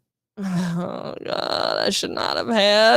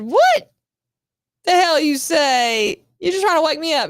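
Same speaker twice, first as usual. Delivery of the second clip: stunned disbelief; 5 semitones higher than usual. Retold or imitated. Wife hearing there's a dead body, incredulous. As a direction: stretch it out in shocked disbelief.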